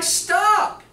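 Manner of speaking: high, loud yell; yelling stop. Retold or imitated. Speaker stopping the nurses' argument, loud high yell. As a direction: shout them down to stop the fight. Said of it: "so this yelling goes".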